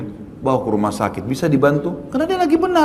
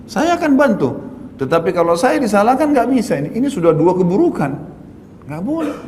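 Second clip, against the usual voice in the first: higher, pitched-up insistence on innocence; retelling how he stood his ground with the crowd, pitched up and firm; firm indignant protest; 6 semitones higher than usual. Retold or imitated. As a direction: refuse the blame, voice rising. Insisting he is not at fault.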